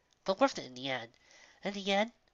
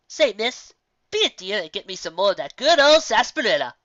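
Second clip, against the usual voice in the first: put-on louder, folksy old frontier lady; old country woman; 2 semitones higher, 8 dB louder than usual. Old woman thanking the audience for listening, hearty and loud. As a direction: hold the voice of a hearty old woman who loves to talk. Roanoke asks Missus Cake.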